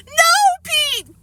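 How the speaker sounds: high tearful shriek; crying scream. A narrator's reading. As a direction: scream it through tears. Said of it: "Fudge screamed through his tears".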